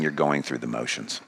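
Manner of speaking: low, soft, sober rebuke; quiet and heavy, letting it sink in; hushed accusation. Acted. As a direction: drop it to a grave near-hush. Same accusation, now hushed.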